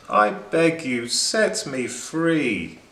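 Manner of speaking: begging. A narrator's reading. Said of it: "again she said".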